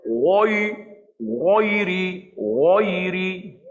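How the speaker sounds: "ghoiri" over-thick and rounded, drawn out; ghain terlalu tebal. Shown as a demonstration. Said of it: "jangan kita baca". (sebutan "ghoiri" salah, terlalu tebal)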